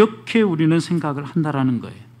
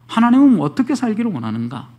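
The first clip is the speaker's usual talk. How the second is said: usual speaking voice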